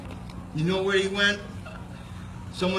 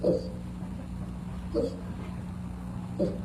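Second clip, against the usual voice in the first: moose call; 7 dB quieter than usual. Shown as a demonstration. Demonstrating cow moose call.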